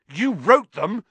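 high, loud, shocked shout. Acted. Harry shouts at Lockhart in outrage. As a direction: fling the question at him. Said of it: "Harry shouted".

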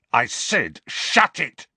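high, loud retort; exasperated command, jumping up in pitch; angry repeated order. A narrator's reading. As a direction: raise your voice to silence the teasing. Repeats his order to taunting cousin.

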